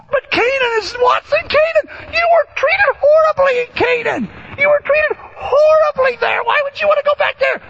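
incredulous skeptic. Retold or imitated. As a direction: play the baffled questioner. Imagined skeptic questions Joseph.

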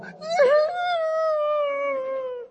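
very high, long fake cry; hammed-up squealing sob; mock sobbing wail. Overwhelmed fan told he is home, mock sobbing wail. Acting out breakdown at welcome center.

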